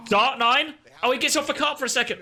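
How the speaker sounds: high, quick gasping excitement; excited gasp. Real, not acted. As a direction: gasp then rush the words. Gasp at close play.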